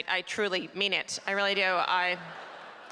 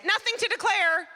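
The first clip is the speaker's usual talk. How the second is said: innocent act; 11 semitones higher, 4 dB louder than usual. Retold or imitated. Lying at the border.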